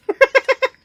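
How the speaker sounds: loud high-pitched giggle. Acted. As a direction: burst into a squeaky laugh. Character laughs in story.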